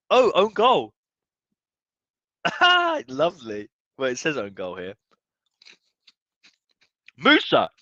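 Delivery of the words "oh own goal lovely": amused surprise. Real, not acted. Learns who scored.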